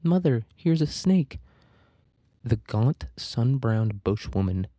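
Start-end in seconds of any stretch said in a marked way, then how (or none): 0.0-1.4 a child's yelled warning, lightly acted; mild yell. A narrator's reading. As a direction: call out to mother in a raised pitch. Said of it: "one of them yells".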